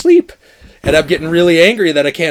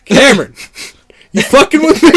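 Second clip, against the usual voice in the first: raised, exasperated call, climbing high; mock indignant shout; 5 dB louder than usual. Imagining what his parents heard at night, indignant shouting. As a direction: call out, then demand an answer.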